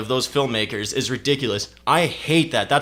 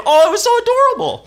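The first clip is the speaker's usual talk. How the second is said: much higher and louder, squealing adorable voice; mock gushing viewer; 8 dB louder, faster than usual. Critic mocks audiences moved by puppy videos, in a squealing high voice. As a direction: coo it like someone melting over puppies. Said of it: "People are probably gonna leave thinking".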